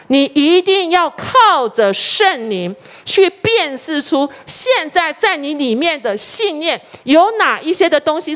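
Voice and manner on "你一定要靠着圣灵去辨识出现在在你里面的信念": slow emphatic. Real, not acted. Preacher presses main point.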